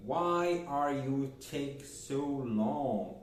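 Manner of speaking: very slow, each word of the English question spaced out; slow teacher demo; slow model question. Shown as a demonstration. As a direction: read it slowly for learners. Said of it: "é why".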